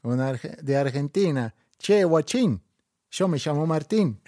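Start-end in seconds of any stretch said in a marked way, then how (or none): 1.8-4.2 higher, louder Argentine football fan; Argentine accent. Shown as a demonstration. Asked for an Argentine accent, loud Argentine football fan. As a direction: cheer Maradona like an Argentine fan. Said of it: "tírate uno argentino".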